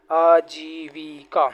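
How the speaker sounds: आजीविका stretched out very slowly; very slow drilled word. Shown as a demonstration. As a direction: draw out आजीविका syllable by syllable. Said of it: "teacher drills the right spelling".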